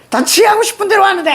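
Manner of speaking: high, fast indignant complaint; acted high-pitched griping; exasperated outburst. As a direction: blurt it high, fed up. Voices exasperated mother Seonsuk.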